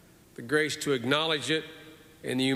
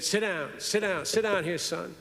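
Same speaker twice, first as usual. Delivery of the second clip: put-on higher, old Southern senator greeting a newcomer; Senator Stennis voice; 5 semitones higher than usual. Old senator welcoming a new young senator, higher imitated Southern voice. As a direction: wave the young man into the chair like an old Southern statesman. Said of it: "he always talked like this".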